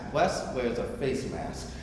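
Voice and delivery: ordinary delivery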